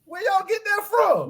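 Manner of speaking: squeaky high shocked disbelief, drawn out; high incredulous shock. Real, not acted. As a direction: act stunned, almost squealing. Incredulous at strange insult.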